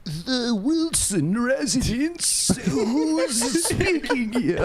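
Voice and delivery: high, slow formal butler. Acted. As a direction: play a stiff old butler answering. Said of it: "let me try to do my old Butler voice".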